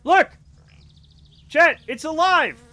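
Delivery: loud, high, triumphant cry; high-pitched elated yell; excited shout. Real, not acted. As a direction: shout the good news to chat. Game success, calling out to chat.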